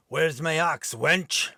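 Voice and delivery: lightly voiced gruff Viking demanding; a touch of a barking warrior's brogue; a hint of a rough Viking. Dan as a Viking demanding his ox from Helen, lightly gruff. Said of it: "rough, deep brogue accent".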